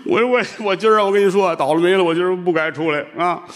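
high, gasping complaint; tearful seller. Acted. Seller gasping out his woes to the monk, high and tearful. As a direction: gasp and stammer out the bad luck.

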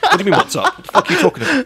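gruff dad, laughing through it; grumpy dad voice. Retold or imitated. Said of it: "And he's like".

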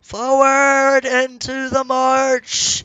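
slow, high, loud war cry; battle cry. Pretend. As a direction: declaim it like a warlord.

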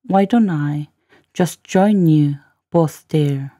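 careful piece-by-piece model reading; slow, chunked demo. Shown as a demonstration. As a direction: break after "Why don't I", then very slowly. Teacher repeats line in slow chunks.

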